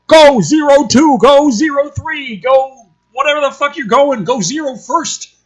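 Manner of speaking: high, loud urgent commands; shouted cockpit orders mid-dogfight; pilot radio calls. Acted. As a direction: call the wingmen in hard.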